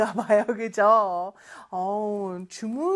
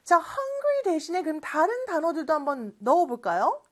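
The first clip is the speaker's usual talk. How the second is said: ordinary delivery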